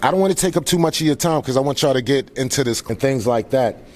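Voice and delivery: usual speaking voice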